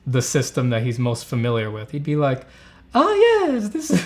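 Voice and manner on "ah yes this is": high-pitched hammed-up Italian accent; loud, high mock-Italian voice; bad Italian accent. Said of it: "let me do the bad Italian accent".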